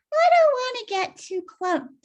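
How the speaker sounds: high, loud prim refusal; fussy Winnie. Acted. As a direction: lift it up, prissy. Winnie voiced in read story.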